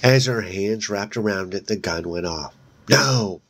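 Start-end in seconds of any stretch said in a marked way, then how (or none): 2.9-3.4 a loud, higher narrated scream. A narrator's reading. Melissa screams as the gun goes off, loud and high. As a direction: throw out a sharp scream of no. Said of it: "Her scream was so loud".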